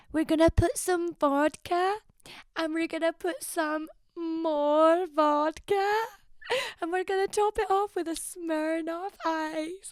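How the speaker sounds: high, slow Irish-Welsh sing-song; bad Irish-Welsh accent. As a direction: imitate a TikTok lady, stretched-out Irish-Welsh lilt. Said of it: "She goes".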